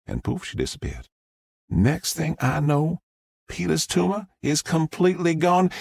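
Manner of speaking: a Southern mom gushing to the press; Southern witness. Acted. Southern witness tells story.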